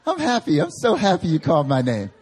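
high, loud, laughing fake cheer; fake happy. The girl faking joy at being called, laughing fake cheer. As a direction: fake your delight through laughter. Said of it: "fake it".

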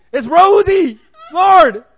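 high laughing shout; mock insistent prayer. Pastor acting Noble insisting to God, high laughing shout. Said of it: "keeps praying and praying".

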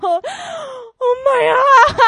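high, slow, loud panicked gasp; loud, drawn-out mock horror; mock panicked parent. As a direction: play the horrified parents, drawn out. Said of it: "they were freaking out".

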